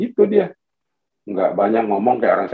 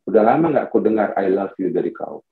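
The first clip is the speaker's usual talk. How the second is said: low, gentle wife's voice; wanting affection; 7 semitones lower than usual. Acted. Wife to drowsy husband at night, low gentle appeal. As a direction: say it low, asking for love. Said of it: "Malam-malam aku dibangunin tuh".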